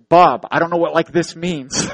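mock exasperation, pushed up and out; frustrated protest. Clueless guide answering blind friend, retold frustration. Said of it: "I'm getting frustrated".

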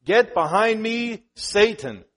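loud, slow, forceful command; Jesus rebuking Peter, slow loud forceful command; stern rebuke. Acted. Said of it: "he rebuked Peter".

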